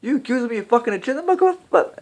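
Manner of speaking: hammed-up movie tough guy, offended; mock outraged yell. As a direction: bark it out as a furious accusation. Quoting an angry movie line.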